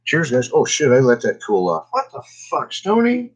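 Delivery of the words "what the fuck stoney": a startled, high squawk; surprised outburst. Real, not acted. Telling Stoney off after letting her drink cool, startled.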